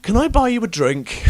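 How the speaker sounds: high, loud, eager birthday wishes; gushing fan. Retold or imitated. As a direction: gush over your hero. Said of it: "it fucking dawns on me".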